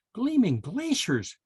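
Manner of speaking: high, slow chirp. A narrator's reading. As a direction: chirp it like a little bird. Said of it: "chirped Tammy Ptarmigan".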